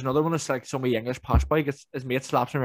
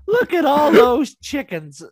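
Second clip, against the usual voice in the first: high, slow drawn-out meme voice; meme quote; 13 semitones higher, 10 dB louder, much slower than usual. Retold or imitated. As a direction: stretch it out high like the viral clip. Quoting viral video meme.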